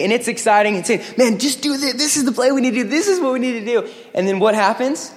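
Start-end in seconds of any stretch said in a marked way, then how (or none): 1.2-2.9 higher, pumped-up team call; hyped huddle shout. Retold or imitated. Quarterback firing up teammates in the huddle, excited. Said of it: "hooting and hollering and getting excited". 2.9-3.9 higher and fast, pumped up; hyped huddle call. Retold or imitated. Quarterback hyping the huddle, higher and fast. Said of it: "hooting and hollering and getting excited".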